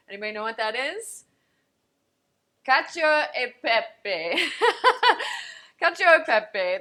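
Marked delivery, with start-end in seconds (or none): 2.7-4.5 hammed-up Italian accent, slow and loud; playful Italian chef voice. Shown as a demonstration. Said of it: "That's my Italian accent". 4.5-5.8 laugh. Real, not acted. Laughs at own accent. 5.8-6.8 loud, higher hammed-up Italian; proud mock Italian chef; Italian accent. Shown as a demonstration. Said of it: "That's my Italian accent".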